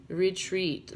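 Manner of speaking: ordinary talk, nothing marked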